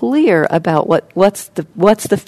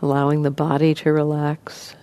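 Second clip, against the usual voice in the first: soft, even voice with long pauses; guided meditation; 8 dB quieter than usual. Acted. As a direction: gently guide listeners into relaxing, leaving space between phrases.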